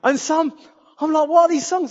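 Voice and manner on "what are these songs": baffled. Retold or imitated. Confused at unfamiliar songs.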